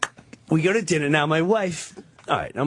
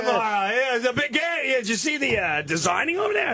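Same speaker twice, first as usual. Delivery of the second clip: much higher, quick chatty distraction; distracting chatter; 9 semitones higher than usual. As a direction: pitch up high and babble to distract.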